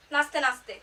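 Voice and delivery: normal talking voice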